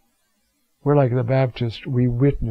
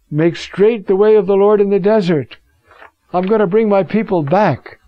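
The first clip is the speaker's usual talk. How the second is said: prophetic call; 6 semitones higher, 4 dB louder than usual. Retold or imitated. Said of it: "it's the one saying".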